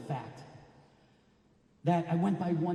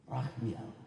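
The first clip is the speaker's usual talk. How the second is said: meek immigrant voice; 2 semitones lower, 6 dB quieter than usual. Retold or imitated. Grandfather repeats name to officer.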